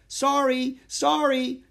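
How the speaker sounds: high, loud, hurried-crowd apology; yelled apology. A narrator's reading. Boy on rampaging elephant to shoppers, yelled apology. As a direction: yell apologies out to passers-by.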